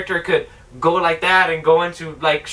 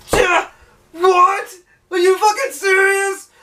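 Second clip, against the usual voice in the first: high-pitched incredulous outburst; hammed-up squeal of outrage; outraged disbelief; 13 semitones higher, 4 dB louder, slower than usual. Reviewer outraged at the plot twist, incredulous high outburst.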